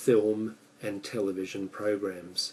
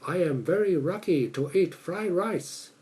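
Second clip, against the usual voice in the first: higher-pitched mock Japanese accent, L and R muddled; imitated Japanese accent; 7 semitones higher than usual. Shown as a demonstration. Speaker demonstrating L and R trouble, imitated Japanese accent. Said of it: "why Japanese people have a hard time saying".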